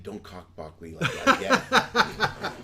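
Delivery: slow, laughing put-on voice; mock Richard Gere. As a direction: drag it out mockingly while laughing. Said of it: "better if he said".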